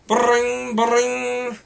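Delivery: usual speaking voice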